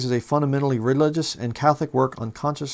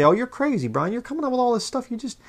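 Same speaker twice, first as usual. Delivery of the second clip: high, mock-whiny imitation of a doubter; much higher mocking whine of a skeptic; mocking the critics; 8 semitones higher than usual. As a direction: parrot the scoffers in a pitched-up sneer. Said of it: "You say".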